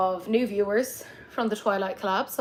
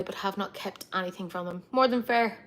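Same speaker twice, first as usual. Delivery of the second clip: ordinary talk, nothing marked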